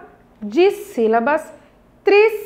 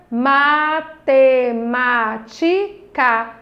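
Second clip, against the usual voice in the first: five slow, separated syllables; much slower than usual. Shown as a demonstration. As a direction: sound out every piece with gaps. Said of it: "cinco sílabas".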